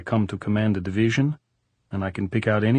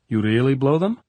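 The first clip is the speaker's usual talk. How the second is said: higher, louder teasing question; 5 semitones higher, 5 dB louder than usual. A narrator's reading. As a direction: needle him playfully. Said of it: "He was teasing now".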